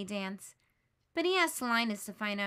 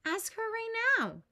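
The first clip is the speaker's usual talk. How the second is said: urging; 10 semitones higher, 4 dB louder than usual. Acted. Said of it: "Linus urges Charlie Brown".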